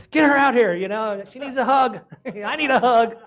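laughing as he replays a panic; a pastor telling his church about a nightmare, frantic and laughing; frantic retold panic. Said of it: "retelling waking from a nightmare".

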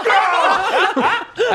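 high laughing battle shriek; battle cry. Pretend. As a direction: screech it mid-laugh. Game character battle cry.

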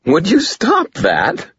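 giggly mock protest; laughing scold. A narrator's reading. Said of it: "more laughter than anger".

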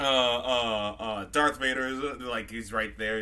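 ordinary talk

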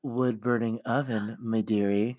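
low, put-on old crone voice; mock old-crone voice. Teasing a friend about pizza in a low crone voice. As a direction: croon it like a storybook granny.

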